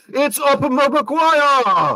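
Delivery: long, high drawn-out commentator call; imitating a commentator. To his co-host, mock French commentator call, slow and high. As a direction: stretch the name out like a French commentator yelling. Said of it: "the commentator going".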